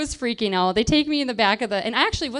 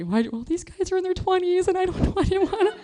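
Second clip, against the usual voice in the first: high, whiny mock-panicked excuse, rushing; freaking out; 8 semitones higher, faster than usual. Teen confessing to the cop, mock freaking out. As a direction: blurt a squeaky excuse, acting out panic. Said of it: "I'm freaking out".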